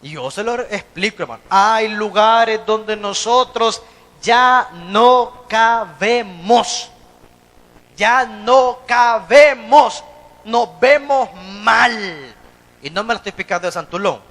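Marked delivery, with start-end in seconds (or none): 1.5-12.3 drawn-out, loud, each word hammered; slow emphatic preaching. Real, not acted. Preacher hammering the point to his congregation, slow and loud. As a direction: pound the words slowly into the congregation.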